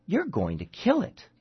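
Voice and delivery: high, cold accusation; mad accusing mother. Acted. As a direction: accuse her, gripped by madness. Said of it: "eyes narrow with madness".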